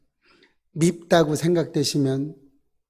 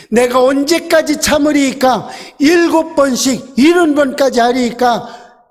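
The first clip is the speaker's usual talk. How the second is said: higher, pleading question; questioning disciple; 9 semitones higher, 5 dB louder than usual. Acted. Preacher voicing Peter asking how many times, higher.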